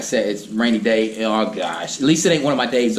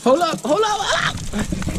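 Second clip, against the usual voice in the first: much higher, startled hold up; excited; slower than usual. Real, not acted. Vlogger spotting a turtle, high and excited. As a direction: call out in surprise at the find.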